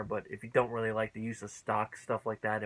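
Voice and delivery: ordinary talk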